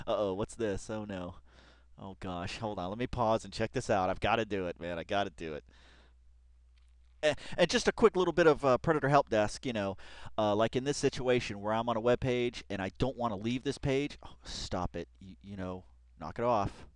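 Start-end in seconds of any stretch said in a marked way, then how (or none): none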